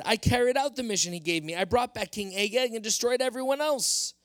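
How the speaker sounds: king wheedling his way out of blame; insisting Saul. Acted. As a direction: insist you did nothing wrong. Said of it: "So Saul insisted".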